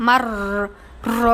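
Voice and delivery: মার র with two heavy trills; both R thick. Shown as a demonstration. Teacher repeats for students, heavy trilled মার র. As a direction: hold the roll in মার, then full র. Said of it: "মোটা".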